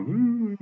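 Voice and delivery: high drawn-out mock woo; high "woo" noise. Storyteller voicing the courtroom blur, high mock woo. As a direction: let out a high-pitched woo. Imitates the judge babbling.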